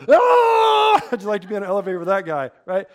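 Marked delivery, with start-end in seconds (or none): 0.1-1.0 hammed-up terrified shriek, much higher and louder; a mock scream of panic. Bob trapped in an elevator, in a hammed-up panicked scream. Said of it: "He screamed".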